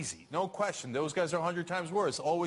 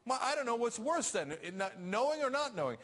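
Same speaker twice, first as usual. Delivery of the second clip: normal talking voice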